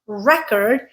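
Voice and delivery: "record" as REC-ord, weak second syllable; stress on first syllable. Shown as a demonstration. Teacher shows the noun, "record" stressed on REC. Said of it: "when I use it that way".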